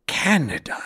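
a mild teasing read of "Canada"; reading a character teasing humans' names; light teasing. A narrator's reading. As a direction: say "Canada" with a hint of a tease. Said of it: "he teased".

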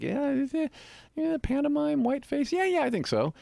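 high-pitched, unsure younger self; imitated eager freshman reply. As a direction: answer like a keen kid guessing, voice way up. Acts out younger self answering recruiter.

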